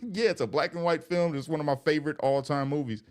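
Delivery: recovering from tears, voice still up; teary, pushing through; still choked up. Real, not acted. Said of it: "And I cry".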